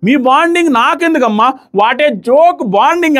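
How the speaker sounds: high, slow whiny mimicry of a sneering woman; mock her changing voice tone to show it irritates; mocking voice imitation. Vlogger mimicking Madhuri dismissing Divya, high mocking whine. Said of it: "ఆ voice టోన్లు మార్చడం ఇవన్నీ irritating".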